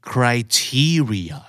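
"criteria" slowly, TE stressed, then falling; drawn-out model of cri-TE-ri-a; stress on second syllable. Shown as a demonstration. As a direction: lean on the TE in "criteria". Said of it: "เน้นที่พยางค์".

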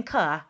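"ka" said with an added uh vowel; wrong schwa sound. Shown as a demonstration. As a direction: sound the letter with an extra vowel. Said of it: "if you're saying".

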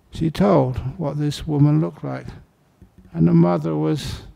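normal talking voice

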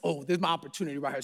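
quick, low, hushed aside to himself; fast quiet self-talk; eager inner thought. Retold or imitated. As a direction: rush it under your breath, spotting a chance.

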